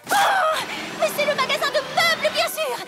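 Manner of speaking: high, loud triumphant call; excited shout. Acted. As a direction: shout it like you've solved it. Figures out the clue.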